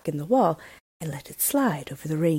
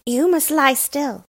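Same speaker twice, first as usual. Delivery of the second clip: put-on a lightly raised, bold robber girl voice; brash young robber girl, a touch higher; 9 semitones higher, 6 dB louder, slower than usual. A narrator's reading. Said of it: "said the robber girl".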